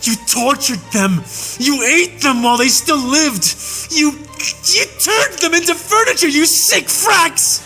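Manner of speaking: high shouting, cracking with anguish at the peaks; a soldier screaming out what he witnessed; anguished rage. A narrator's reading. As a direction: let grief and fury break through the yell. Tirade over remembered horrors.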